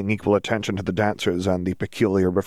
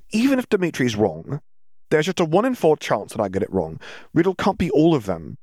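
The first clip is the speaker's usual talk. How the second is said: higher-pitched, emphatic character voice; insistent Ginny; 7 semitones higher than usual. Acted. Ginny cuts Draco off to argue, insistent. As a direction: argue back, pitched high.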